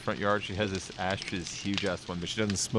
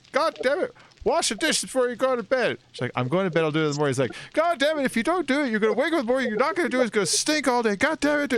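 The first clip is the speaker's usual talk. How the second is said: much higher, louder mock rant; imitating angry dad; 4 dB louder, faster than usual. Dad yelling at daughter about dirty dishes, mock furious. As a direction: rant like a father losing patience. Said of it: "He's getting pissed".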